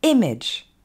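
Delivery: IMAGE as "EM-edge", opening high and loud; falling English IMAGE with short "eh" vowels; stress on first syllable. Shown as a demonstration. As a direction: hit the start of IMAGE, then drop to "edge". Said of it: "l'accent tonique tombe sur la première syllabe".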